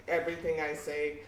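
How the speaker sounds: ordinary talk, nothing marked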